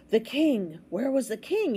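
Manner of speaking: a touch of startled alarm; gasped alarm. A narrator's reading. Bartholomew suddenly remembering the missing king, gasping. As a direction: gasp it in sudden realisation. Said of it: "Bartholomew gasped".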